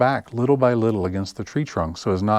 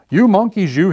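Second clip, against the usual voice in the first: much higher, louder scolding; scolding monkeys; 10 semitones higher, 8 dB louder than usual. Acted. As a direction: wag a finger and scold them. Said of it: "This made the peddler angry".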